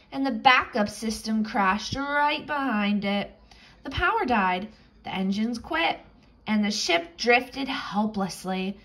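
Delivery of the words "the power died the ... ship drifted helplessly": usual speaking voice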